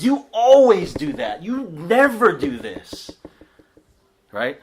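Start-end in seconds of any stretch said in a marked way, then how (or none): 0.0-3.1 much higher, louder and slow, hammed-up; mocking a spouse who says always and never; mock nagging spouse.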